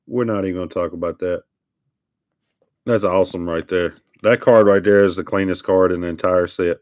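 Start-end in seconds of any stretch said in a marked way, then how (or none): none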